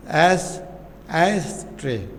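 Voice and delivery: एस्ट्रे broken up after a first try at एस; pause, then give एस्ट्रे in distinct pieces; syllable by syllable. Shown as a demonstration. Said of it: "वो उसका syllable कहलाएगा". (एस्ट्रे को हिस्सों में, ठहर कर)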